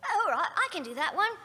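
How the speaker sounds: put-on high, loud, quick little-boy voice; little British boy. Actor accepts the British boy job, in boy voice. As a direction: pipe up as an eager British boy. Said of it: "It's a little British boy".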